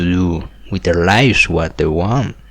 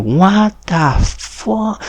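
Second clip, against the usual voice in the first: high, slow, stretched-out disbelief; incredulous; 9 semitones higher, 3 dB quieter, much slower than usual. Retold or imitated. Reacting to being taken to jail, slow high incredulity. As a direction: drag out each word in mock bafflement.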